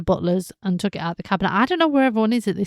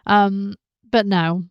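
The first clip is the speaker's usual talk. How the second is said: ordinary talk, nothing marked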